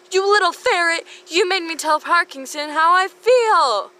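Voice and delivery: high, loud furious yell; hammed-up livid shriek. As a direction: scream at the ferret who tricked you. Hermione furious at Draco.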